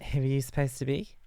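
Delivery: high, mock-snooty voice of the stranger; mocking girl's question. A girl at the party sneering at his costume, high and snooty. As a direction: ask it like a snide party girl. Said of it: "She was like".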